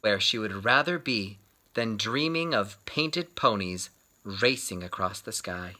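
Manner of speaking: usual speaking voice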